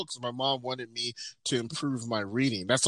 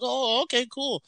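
much higher, exaggerated polite "okay"; mock polite; 12 semitones higher than usual. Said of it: "I go".

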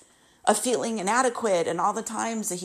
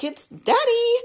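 much higher, sing-song imitation of dad announcing himself; high mock Daddy voice; 4 dB louder than usual. Imitating dad calling his daughter out of the blue, much higher and sing-song. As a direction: mimic dad chirping his own arrival. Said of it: "He's like".

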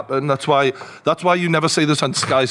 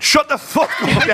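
high, slow laughing mock snap; mock yell; 11 semitones higher, slower than usual. Retelling telling a friend to shut up, mock. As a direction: fire it off at him jokingly. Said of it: "I went".

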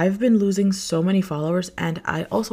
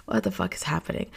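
baffled aside; 8 semitones lower, 6 dB quieter, faster than usual. Pretend. Baffled aside to viewers, low and soft. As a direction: drop low and soft, mock bewildered.

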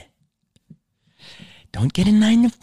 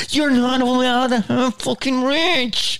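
mock scolding; 7 semitones higher than usual. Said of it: "grab them and say".

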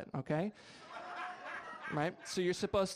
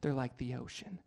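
mock sensual; 4 semitones lower, slower than usual. Husband trying sweet talk on his wife, mock sensual. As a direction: lay on the romance like a bad soap. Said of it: "like, sensual".

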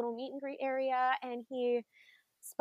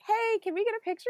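bright, quick, high excited ask; eager ask; 10 semitones higher, faster than usual. Retold or imitated. Fan asking Donald for a photo, excited and high. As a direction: pitch it up like a thrilled fan. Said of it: "And we're like".

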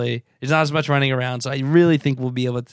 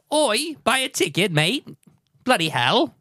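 higher-pitched mock Cockney shout-out; Cockney accent; 9 semitones higher, slower than usual. Podcaster telling British fans to buy tickets, Cockney accent. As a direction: put on a playful Cockney, pitched up. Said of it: "say it in your accent for you".

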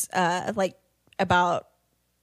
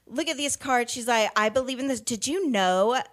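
mock imitation of mom; 4 semitones higher than usual. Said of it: "her oldest imitating her".

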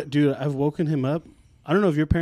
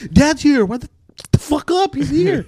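high, loud yelled announcement; bursting with news for the siblings; excited kid shout; 11 semitones higher, 5 dB louder than usual. Retold or imitated. Kid telling siblings dad's home.

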